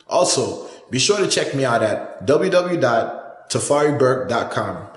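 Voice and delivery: loud, high, upbeat channel promo; hyped outro announcer. Acted. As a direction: pump up the subscribe pitch. Energetic outro announcer read.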